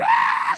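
very high mock shriek of a spirit; demon shriek. Preacher imitating a demon cast out, very high shriek. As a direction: squeal like a demon being cast out. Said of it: "make noises and sounds".

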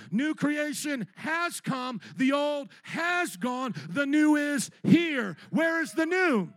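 slow, loud, high proclamation; full-force preaching. Real, not acted. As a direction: drive each word home to the congregation.

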